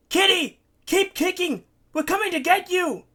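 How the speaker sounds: high, full-force shout to a swimmer; calling out. A narrator's reading. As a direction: yell it across the water. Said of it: "Larry calls out".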